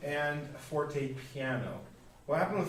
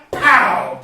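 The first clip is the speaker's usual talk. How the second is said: a much higher, much louder punchy pow; 10 semitones higher, 21 dB louder than usual. Shown as a demonstration. Teacher contrasting forzando with a loud pow. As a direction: hit it like a punch in the nose. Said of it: "the forzando is more like a woo".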